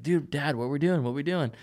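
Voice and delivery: a touch of puppyish excitement; excited dog. Acted. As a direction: voice an excited dog pestering his dad. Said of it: "he's just all excited, ready to go".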